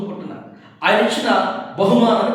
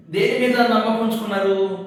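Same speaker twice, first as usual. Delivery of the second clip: ordinary delivery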